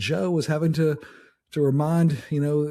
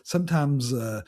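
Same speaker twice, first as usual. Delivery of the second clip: usual speaking voice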